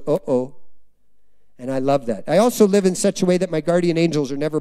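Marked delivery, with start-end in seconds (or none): 0.1-0.5 low little uh-oh; quietly dismayed, pitched down low; worried devil. Pretend. Preacher voicing the devil facing a surrendered believer, worried. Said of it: "the devil goes".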